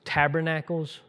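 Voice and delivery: normal talking voice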